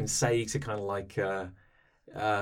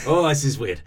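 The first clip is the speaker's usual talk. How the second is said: put-on laughing, over-the-top French accent; outrageous French accent; 5 semitones higher, 10 dB louder than usual. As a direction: ham up the French accent through giggles. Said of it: "an outrageous, uh, French accent".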